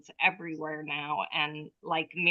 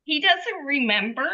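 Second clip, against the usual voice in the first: higher-pitched voicing of an innocent dog; imagined dog's voice; 8 semitones higher than usual. Dog telling owner all is forgotten, higher imagined dog voice. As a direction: lift the pitch to speak for the dog. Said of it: "it's like he's saying to me".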